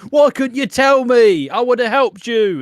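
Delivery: high, loud, drawn-out angry yell; really mad. Acted. Grant confronting Nigel for hiding it, acted fury. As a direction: blow up in hammed-up outrage. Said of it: "he gets really mad".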